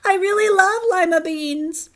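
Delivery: loud, high urgent admission; blurted confession. Acted. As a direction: finally burst out the truth. Camilla's outburst confession.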